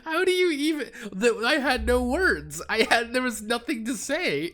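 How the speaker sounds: very high, helpless talk through laughter; squealing with real laughter; laughing hard. Real, not acted. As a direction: push the words out while cracking up. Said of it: "I'm just laughing my ass off".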